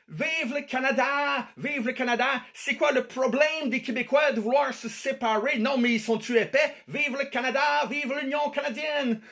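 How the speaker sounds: high-pitched imitation of a bawling girlfriend; mock yelling. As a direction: chant it high like a loudmouthed patriot, without real volume. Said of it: "en gueulant". (imitation criarde, en gueulant dans l'aigu)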